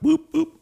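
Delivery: two high, punchy boops; high-pitched double boop; bumping sound effect. Retold or imitated. Imitates phones bumping in app.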